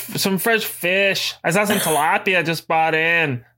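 high-pitched, hammed-up Boston accent; bad Boston accent. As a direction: insist in a badly faked Boston accent. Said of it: "It's not Boston".

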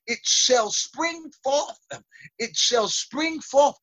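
slow, high, drawn-out proclamation; preacher proclaiming with weight; scripture declamation. Real, not acted. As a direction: stretch out the promise for the congregation.

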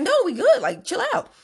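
high, loud protest; exasperated high-pitched refusal; loud brush-off. Retold or imitated. Brushing off the manager again.

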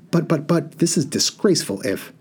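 a flustered bird spluttering at the genie; lightly voiced indignant hoopoe; sputtering hoopoe. A narrator's reading.

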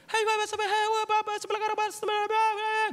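high, monotone hawker calls; distant market chatter. Retold or imitated. Ghost-market traders heard from afar, imitated distant chatter. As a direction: imitate faraway traders calling out in a high pitch. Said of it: "ngedenger suara transaksi dari kejauhan gitu".

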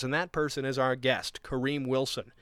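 usual speaking voice

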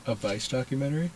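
talking through a yawn; words stretched by a yawn. Real, not acted. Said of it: "Thanks for making me yawn".

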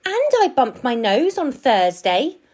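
high, loud little squirrel voice; complaining squirrel. A narrator's reading. Squirrel listing his hurts to the park keeper, plaintive.

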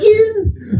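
high squeaky imitation; mock squeaky yeah. Grown man mocked, squeaky and high. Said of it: "He goes".

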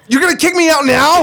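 outraged disbelief. Pretend. Acted girl outraged.

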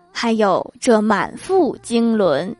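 slow, self-important pronouncement; pompous drawl. Retold or imitated. Dad grandly explains his weight to his son, slow. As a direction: draw it out grandly like a proud dad.

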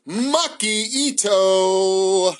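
hyped, very slow name announcement; grand announcer intro. Pretend. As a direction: stretch the name out like a ring announcer. Grand introduction of main event favourite.

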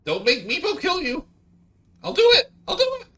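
very high, loud squeaky threat; blustering little dragon threat. Acted. Meepo threatens the adventurers in a loud high squeak. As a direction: puff up and bluster like a tiny beast.

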